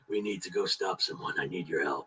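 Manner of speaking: low, soft put-on hero voice; hushed, deep-pitched mock-dramatic plea; character's plea for help. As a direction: drop low and quiet to ask for help. Voicing a character after the theme.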